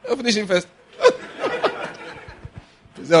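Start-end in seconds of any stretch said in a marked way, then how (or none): 1.0-2.1 high laugh. Real, not acted. Laughs at own joke.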